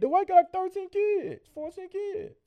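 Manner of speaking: shrill disbelief climbing high; excited rant. Real, not acted. Ranting to friends about a player with many kids, high excited voice.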